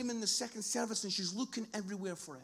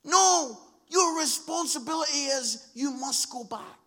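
loud, high opening NO, then firm; forceful command; 4 semitones higher, 9 dB louder than usual. Acted. Captain being told to go back, forceful command. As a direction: order them back with full conviction.

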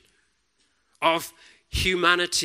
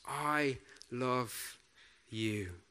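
tender whisper; 3 semitones lower, 10 dB quieter, much slower than usual. Pretend. God speaking tenderly to us.

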